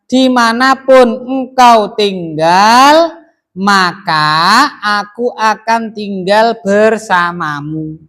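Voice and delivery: booming, drawn-out recital; loud declaiming. Shown as a demonstration. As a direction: proclaim the translation like a vow to the room. Teacher declaims the translation.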